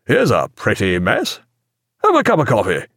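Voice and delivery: lightly voiced cheerful captain; a touch of breezy good cheer. A narrator's reading. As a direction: make light of trouble, breezily. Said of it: "He sounded quite cheerful".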